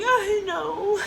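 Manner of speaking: high, whimpered reply; squeaky fake sob; mock-crying answer. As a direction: imitate agreeing through tears. Retells answering mom while crying.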